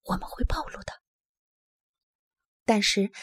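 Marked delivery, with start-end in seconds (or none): 0.0-0.9 urgent whisper; frightened bear hiding from hunters, whispering. Acted. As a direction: hush him without voice. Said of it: "他小声说".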